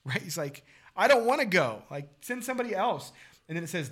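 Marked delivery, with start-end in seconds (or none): 1.0-3.1 high and loud whine on "I don't wanna go"; mock childish whining Moses; whiny refusal. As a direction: protest like a sulking kid. Moses refusing God's call.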